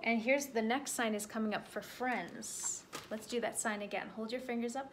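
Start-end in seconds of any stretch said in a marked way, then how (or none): none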